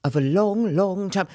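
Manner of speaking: slow, drawn-out mock British accent; bad British accent. Mocking an actress telling her story, in a bad British accent. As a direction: imitate a badly done British accent, slowly. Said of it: "her accent to me was so bad".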